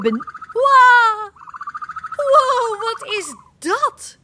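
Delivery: a mother stopped short by what she sees; high startled gasp of wow; startled mama. Acted. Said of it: "Roept mama".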